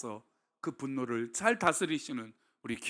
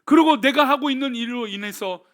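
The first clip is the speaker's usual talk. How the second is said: solemn, intoned blessing over the congregation; benediction; 5 semitones higher, 8 dB louder than usual. Acted. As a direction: pronounce the blessing steadily. Benediction prayer. (엄숙한 축도)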